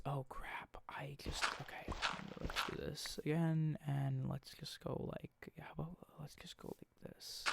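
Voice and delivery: hushed, half-whispered aside; muttering to self. Real, not acted. Player fixing a slip mid-story, muttered to self. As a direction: mumble it under your breath, trailing into a whisper. Talking to self over game mishap.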